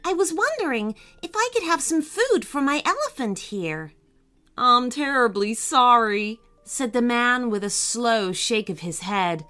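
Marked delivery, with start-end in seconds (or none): 0.0-3.9 higher, bright polite request. A narrator's reading. Annika asking the farmer for elephant food, brightly. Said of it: "said Annika brightly". 4.6-6.4 lightly voiced sorry farmer; sad farmer. A narrator's reading. Unhappy farmer turning Annika away, mildly sad farmer voice.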